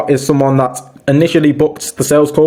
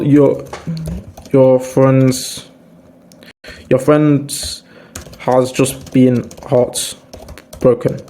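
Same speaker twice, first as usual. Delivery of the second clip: gentle sympathetic tone; much slower than usual. Shown as a demonstration. Comforting a heartbroken friend, slow and gentle. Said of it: "speak to them with a different tone of voice".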